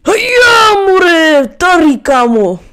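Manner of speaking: loud excited call, pitched high. Real, not acted. Calling his buddy over in the game, loud and excited. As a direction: yell across to your buddy.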